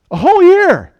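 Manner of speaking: big ringing emphasis on "whole"; emphatic repeat. Real, not acted. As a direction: hammer home "whole". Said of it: "repeated for emphasis".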